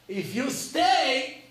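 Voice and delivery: very slow, high and loud; a slow dire warning; ominous warning. Acted. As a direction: spell out the doom slowly.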